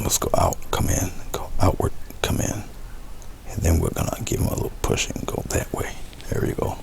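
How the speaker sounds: soft whispered guidance to the brush; whispering. Real, not acted. Painter coaxing each petal out in a whisper. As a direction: hush it out stroke by stroke, unvoiced.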